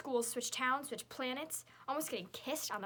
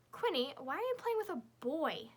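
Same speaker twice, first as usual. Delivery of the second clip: huffy; 6 semitones higher, 4 dB louder than usual. A narrator's reading. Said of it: "Victoria huffs at me".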